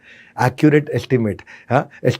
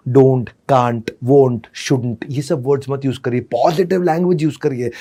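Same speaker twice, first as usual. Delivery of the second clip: normal talking voice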